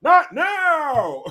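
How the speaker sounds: high, wailing mock yell; sudden hammed-up cry, cracking into laughter; weird outburst. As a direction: imitate a strangled shout that came out wrong. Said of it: "It just came out fucking weird".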